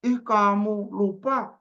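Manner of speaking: high, drawn-out, teasing complaint; mock reproach. Schoolmate chides friend for forgetting childhood, high mock reproach. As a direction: imitate a friend chiding, very slowly.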